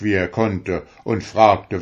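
ordinary delivery, nothing marked

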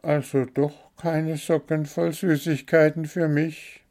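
high bird voice, a hint of letdown; disappointed little bird. A narrator's reading. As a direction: bird asking for sweets, mildly crestfallen.

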